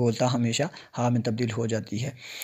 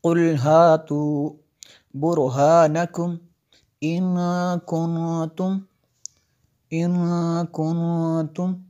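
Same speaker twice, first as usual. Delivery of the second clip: slow, higher recitation with nasal ghunna in कुंतुम; Quran recitation; 3 semitones higher, 3 dB louder, much slower than usual. Shown as a demonstration. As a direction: recite slowly, humming the न of कुंतुम. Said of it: "यहाँ पे मैंने गुन्ना किया". (कुंतुम में गुन्ना के साथ धीमी तिलावत)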